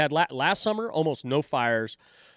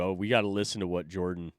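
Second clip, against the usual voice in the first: ordinary delivery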